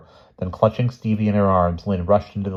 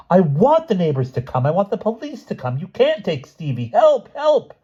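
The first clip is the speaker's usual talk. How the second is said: high, loud protest rising into screams; high-pitched frantic defiance; defiant screaming; 13 semitones higher, 5 dB louder than usual. A narrator's reading. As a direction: shout them down, then scream for help. Lynn defying Judith, screaming for help.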